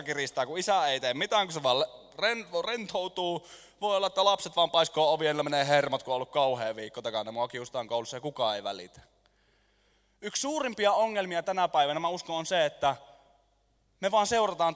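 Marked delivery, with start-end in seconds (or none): none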